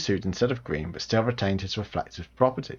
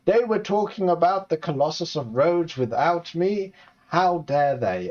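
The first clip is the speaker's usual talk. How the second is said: outraged friend; 7 semitones higher than usual. Retold or imitated. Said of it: "He will be like".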